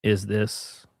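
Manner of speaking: normal talking voice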